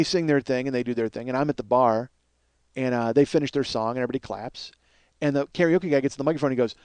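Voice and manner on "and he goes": ordinary delivery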